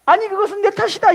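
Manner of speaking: put-on high, loud old woman's voice; mother-in-law taking blame. Mother-in-law claiming the blame, high and loud. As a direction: insist it's her fault. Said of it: "시어머니가".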